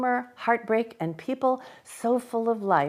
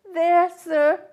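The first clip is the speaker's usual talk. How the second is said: put-on timid child; 8 semitones higher, 6 dB louder than usual. A narrator's reading. Frightened Pip.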